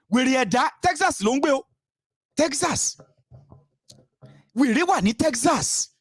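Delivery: mocking jeer. Mocking the critic.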